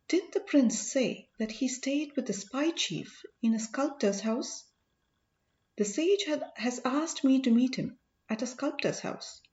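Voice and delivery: ordinary delivery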